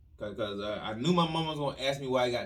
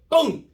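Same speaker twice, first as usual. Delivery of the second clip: punchy loud impact noise; loud boom; 11 semitones higher, 10 dB louder than usual. Retold or imitated. The belt landing, a loud impact noise. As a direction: hit it hard.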